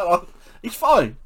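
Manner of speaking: high-pitched mock reassurance; retold reassurance. Reassuring the alarmed wife.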